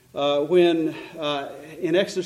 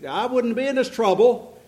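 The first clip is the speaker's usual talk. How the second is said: higher, louder accusing protest; complaining; 6 semitones higher, 5 dB louder than usual. Acted. Adam blaming God for the woman, complaining loudly. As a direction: protest and shift the blame upward.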